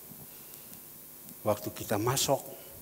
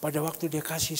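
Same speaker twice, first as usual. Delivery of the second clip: ordinary delivery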